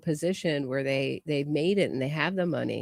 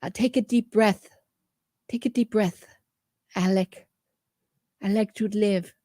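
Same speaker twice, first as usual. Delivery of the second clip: higher, louder mock Spanish accent; 3 semitones higher, 4 dB louder than usual. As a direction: keep the put-on Spanish accent going. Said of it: "I wish I could do a Spanish accent".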